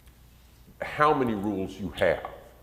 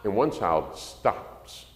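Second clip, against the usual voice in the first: ordinary talk, nothing marked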